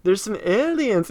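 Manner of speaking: lightly hammed-up flustered pitch; imitating flustered. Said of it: "he's, like, really flustered".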